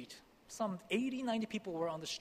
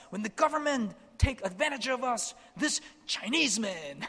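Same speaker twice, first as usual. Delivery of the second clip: rally the crowd, shouting in defense; 7 semitones higher, 5 dB louder than usual. Retold or imitated. Villagers shouting at the sheikh in defense, loud high protest. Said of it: "the people in the back started shouting".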